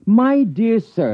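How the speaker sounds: slow, lofty, high-pitched disdain; haughty. Acted. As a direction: look down your nose as you say it. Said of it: "don't wrinkle your nostrils at me".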